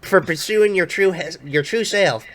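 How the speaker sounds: high, loud Southern ally; Southern accent. Acted. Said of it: "like Ellis from Left four Dead".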